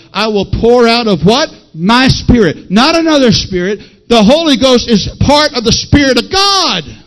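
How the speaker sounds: emphatic pulpit delivery building to a shout; forceful preaching. Real, not acted. Preacher expounding Joel's prophecy to the congregation, forceful and rising. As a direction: hammer each phrase, ending in a shout.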